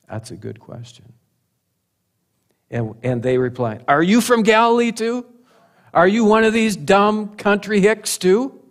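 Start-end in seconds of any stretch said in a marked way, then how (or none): 3.9-8.6 high, incredulous jeering questions; mock them with raised-pitch scorn; insulting. Leaders reply to Nicodemus who spoke up, insulting. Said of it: "Look how insulting they are".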